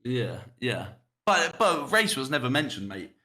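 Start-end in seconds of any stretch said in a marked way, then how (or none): none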